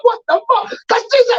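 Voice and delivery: very high shouted outrage; outraged yell. Retold or imitated. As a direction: yell it like a furious fan. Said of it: "you were like".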